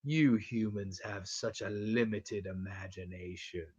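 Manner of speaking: a lightly voiced cold demon, taking its time; mock Pinhead. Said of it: "Pinhead just goes".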